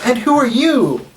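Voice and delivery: high, lilting question; curious aunt. Acted. Innkeeper aunt asking the adventurers, high curious voice. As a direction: ask strangers in a high voice.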